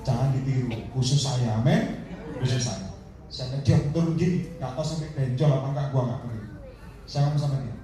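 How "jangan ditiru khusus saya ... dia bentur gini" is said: normal talking voice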